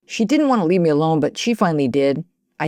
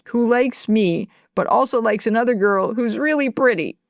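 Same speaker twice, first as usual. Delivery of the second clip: crying; 7 semitones higher than usual. Acted. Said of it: "so you may as well stop crying".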